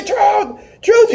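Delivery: very high-pitched mock yell; strained falsetto cry after someone fleeing; mock calling out. Everybody chasing Truth through the plane, high mock yelling. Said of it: "everybody's chasing him and trying to say".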